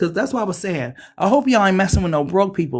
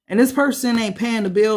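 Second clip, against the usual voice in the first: ordinary talk, nothing marked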